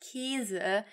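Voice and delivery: 'Käse' said like 'Keese', long closed e; slow 'Käse' with a tense, narrow e; regional closed E. Shown as a demonstration. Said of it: "but rather".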